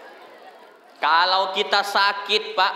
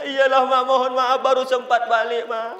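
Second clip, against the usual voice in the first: raised, pleading mock-tearful voice; apologising child; 7 semitones higher, 4 dB louder than usual. Child begging mother's pardon for late return, acted pleading. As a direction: plead higher, like a remorseful child.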